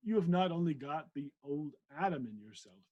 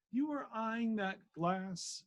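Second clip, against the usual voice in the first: slow, higher mock posh English "glass"; 9 semitones higher, 4 dB louder, slower than usual. Shown as a demonstration. Reader retrying the vicar's line in mock posh English. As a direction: put on a hammy posh English accent. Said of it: "that's why I don't do accents".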